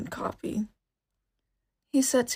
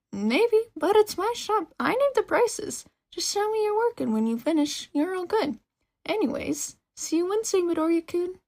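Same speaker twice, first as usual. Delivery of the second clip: much higher, bright-voiced girl teasing him; cheerful shop girl; 9 semitones higher, 5 dB louder than usual. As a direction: grin through it, she sets the prices.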